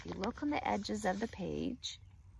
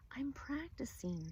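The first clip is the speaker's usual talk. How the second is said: soft slow whisper; a boy's hushed whisper; 5 semitones higher, 8 dB quieter than usual. Acted. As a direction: breathe it out unvoiced. Tom rehearsing quietly.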